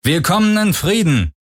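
high-pitched hail to strangers; calling out. A narrator's reading. Stranger hails the crew over a comm, calling out high. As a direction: call out a greeting, pitched well up.

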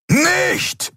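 very high yelled warning; roaring. A narrator's reading. Keru roars to stop Nelen's charge. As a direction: yell it out in alarm. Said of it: "brüllte Keru".

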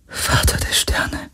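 slow, hushed whisper; awed whisper. A narrator's reading. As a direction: whisper it slowly, like a prayer. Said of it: "flüsterte Kirai". (ehrfürchtig geflüstert)

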